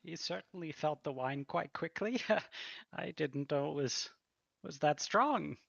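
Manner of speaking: gently teasing waitress; amused waitress. Acted. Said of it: "she kinda chuckles and says".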